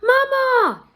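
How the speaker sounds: high, loud desperate call; crying out. A narrator's reading. Boy calling after his mother being dragged away, desperate. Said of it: "cried Alfred after her".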